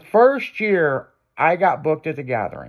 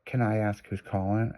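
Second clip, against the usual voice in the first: put-on soft, low phone-girl voice; phone sex operator; 5 semitones lower, 9 dB quieter than usual.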